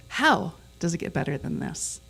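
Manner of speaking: "How" punched, then a pause before the rest; stress on How. Shown as a demonstration. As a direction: lean hard on "How" and let the rest drop. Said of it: "where you emphasize each word in a sentence".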